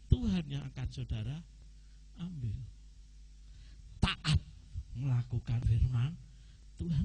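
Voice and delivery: ordinary delivery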